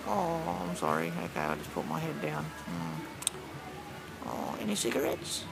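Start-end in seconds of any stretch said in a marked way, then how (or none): 0.6-3.3 meek apology. Acted.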